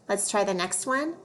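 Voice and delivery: ordinary delivery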